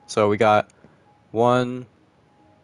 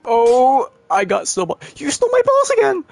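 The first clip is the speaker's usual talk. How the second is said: very high, loud squealed complaint; mock outcry; 13 semitones higher, 4 dB louder than usual. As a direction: shoot up into a squeal of playful outrage. Outcry over stolen snowballs in game.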